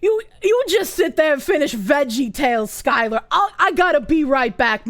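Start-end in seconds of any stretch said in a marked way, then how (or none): none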